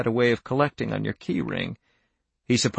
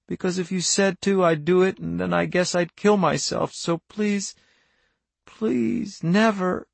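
put-on high-pitched, tearful child's plea; weeping; 7 semitones higher than usual. A narrator's reading. Daughter begging her father never to ask, weeping. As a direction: plead through acted tears, voice lifted high. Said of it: "she wept".

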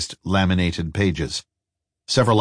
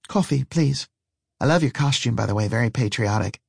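higher, courteous character voice; lightly voiced polite woman customer; 4 semitones higher than usual. Woman complimenting the waitress's costume, lightly voiced, higher and polite. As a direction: lift into her voice for a polite compliment.

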